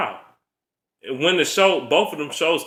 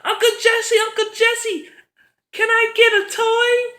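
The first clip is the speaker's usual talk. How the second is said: put-on much higher bratty kid wheedling her uncle; mock kid voice. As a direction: plead like a spoiled little kid tugging a sleeve. Mocking a sitcom kid begging.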